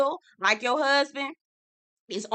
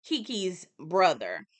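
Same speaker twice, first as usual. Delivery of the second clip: usual speaking voice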